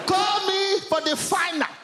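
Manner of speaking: high, drawn-out command; imperious mum. Acted. Comedian voicing her unimpressed mum, imperious high call. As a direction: call it out high and slow, brooking no argument. Mum's dismissive order, acted by comedian.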